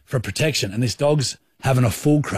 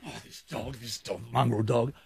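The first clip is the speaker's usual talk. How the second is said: very soft angry muttering under the breath; muttering to himself; 12 dB quieter than usual. Retold or imitated. Pacing by the ute, muttering softly about the dog. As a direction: grumble quietly while pacing. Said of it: "I was going pacing".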